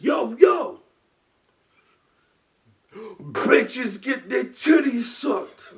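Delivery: high, slow shouted rap line; mock rapper shout. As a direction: bellow it slowly like on stage. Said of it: "And he's like, shout".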